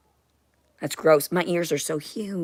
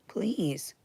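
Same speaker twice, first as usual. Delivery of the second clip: whispered please; 8 dB quieter than usual. Real, not acted. Dismissive plea.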